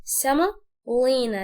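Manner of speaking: سملينا very slowly in two parts, the O left weak; unclear O, drawn out. Shown as a demonstration. Teacher breaking the word down for learners, slow unclear O in سملينا. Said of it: "الاو دي مش هنوضح نطقها".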